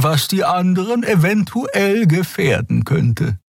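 high, slow, measured character voice; calm mediator. Acted. Crewmate proposing equal votes among friends, slow and calm. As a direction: lay it out slowly and evenly, pitched high. Voices Xea laying down terms.

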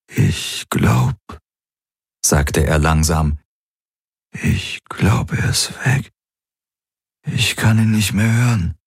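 rough, barely above a whisper; hoarse whisper. Acted. Exhausted Galen tells them, in a hoarse whisper. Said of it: "war seine Stimme rau, kaum mehr als ein Flüstern".

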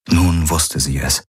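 ordinary delivery, nothing marked

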